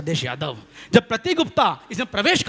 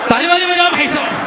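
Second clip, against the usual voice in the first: high, loud hype call; loud call for applause; 10 semitones higher, 7 dB louder than usual. Real, not acted. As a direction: whip the crowd into clapping. Asks audience for applause. (ज़ोर से तालियों की माँग)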